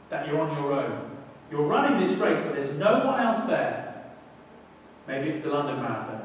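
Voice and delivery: normal talking voice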